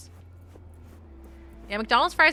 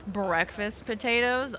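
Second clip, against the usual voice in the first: usual speaking voice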